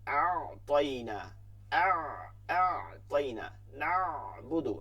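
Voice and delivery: Arabic letter ain demonstrated; Arabic ain demo. Shown as a demonstration. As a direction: squeeze the ain from the throat. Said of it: "করতে হবে একটু চাপ দিয়া".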